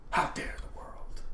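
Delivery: slow whispered lament. Real, not acted. Vlogger lamenting to viewers about life, slow whisper. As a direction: breathe it out slowly like a mock tragic sigh.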